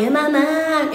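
high, drawn-out mock singsong; mocking quote. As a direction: mimic a lovesick daydreamer, stretching the last word. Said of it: "You over there talking about".

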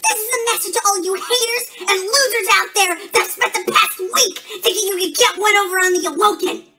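loud, high heated address; angry promo callout. Acted. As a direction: call out the haters at full volume. Villain wrestler taunting haters in promo.

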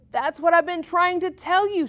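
high loud yell; exasperated yell. A narrator's reading. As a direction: shout it in frustration. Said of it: "Stevie yelled".

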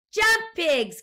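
high, loud order to jump; cried out. A narrator's reading. Explorer guinea pig cries out to the pigs, loud and high. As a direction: shout it urgently. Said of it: "cried Fluffy the Explorer".

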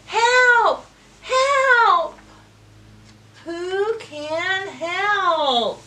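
high, loud cry for help; duck yelling for help. Acted. Duck stuck in the muck calls for help, loud high shout.

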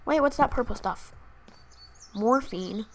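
usual speaking voice

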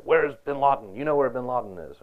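half-scream it like a TV interrogation; mock interrogator scream. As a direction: imitate interrogators yelling at a suspect. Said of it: "They scream at him".

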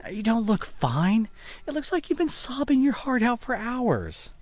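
high, slow, alarmed; high worried concern. Acted. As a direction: react to her tear-stained face. Seeing girlfriend has been crying.

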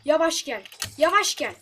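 urging shout. Real, not acted. Loudly urging slow.

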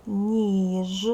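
Ніж loud, with long і and buzzing ж; drawn-out word. Shown as a demonstration. Teacher showing children the changed word, Ніж drawn out clearly. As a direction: stretch Ніж and voice the final ж. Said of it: "змінюється".

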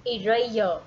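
eraser said as i-ray-zo, falling tune; model word eraser. Shown as a demonstration. Teacher models eraser for pupils to repeat, clear. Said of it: "Các em đọc theo cô".

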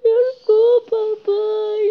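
crying apology. Acted. Child apologizing in skit.

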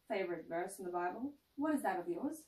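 ordinary delivery

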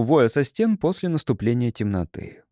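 ordinary delivery, nothing marked

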